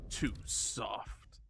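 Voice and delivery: breathy whisper under the breath; murmuring to himself in a whisper. Real, not acted. Streamer mutters to himself, hushed whisper. Whispered aside during game.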